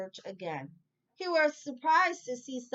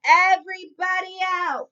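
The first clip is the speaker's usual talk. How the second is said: loud, high call of everybody out; shouted order; 10 semitones higher, 11 dB louder than usual. Acted. Manager to customers, loud high shout. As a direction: boom it across the laundromat.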